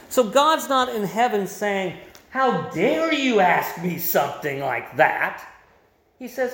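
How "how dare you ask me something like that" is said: mock-indignant divine scolding, slow and weighty; hammed-up outrage, slowly stressed; indignant rebuke. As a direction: play an offended God, drawing out each word. Mock divine rebuke God isn't making.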